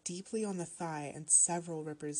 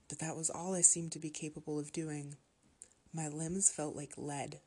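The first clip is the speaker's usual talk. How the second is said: ordinary delivery, nothing marked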